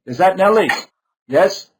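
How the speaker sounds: audio breaking up over a bad connection; choppy, glitching internet call voice; glitchy robotic call. Real, not acted. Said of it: "because your audio is breaking up?".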